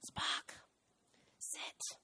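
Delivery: hushed, breathy command; whispered command. Shown as a demonstration. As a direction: whisper it to the dog. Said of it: "if you talk to your dog and go".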